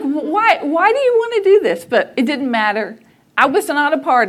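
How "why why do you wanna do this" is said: incredulous. Retold or imitated. Disbelief at mom agreeing.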